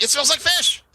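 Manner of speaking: very high, squeaky goblin complaint; outraged goblin. Acted. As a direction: protest like a cheated little goblin. Tricked goblin complains about tin.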